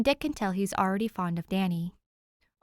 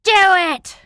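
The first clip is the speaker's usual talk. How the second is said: much louder, higher shout; hype shout; 11 semitones higher, 17 dB louder than usual. Real, not acted. Reader hyping up finishing the chapter, shout. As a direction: pump yourself up and yell. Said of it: "lock in and do it".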